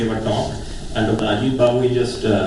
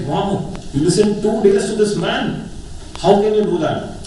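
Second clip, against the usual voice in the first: higher, astonished wonder; amazed; 6 semitones higher than usual. Retold or imitated. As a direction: marvel at it, up high. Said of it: "you realize".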